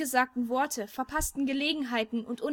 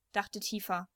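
ordinary delivery, nothing marked

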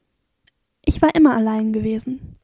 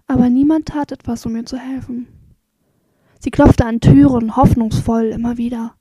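ordinary delivery, nothing marked

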